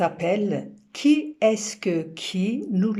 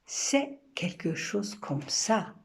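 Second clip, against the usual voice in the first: poem recitation. Acted. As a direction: recite it gently, letting each image land. Recites her poem Comprendre.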